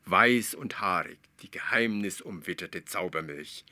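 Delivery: usual speaking voice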